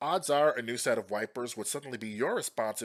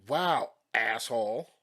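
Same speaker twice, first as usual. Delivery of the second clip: slow, drawn-out indignant retort; mildly raised, acted jab; yelling back; 5 dB louder, slower than usual. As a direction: stretch it out like an offended neighbor. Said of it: "And she yelled back".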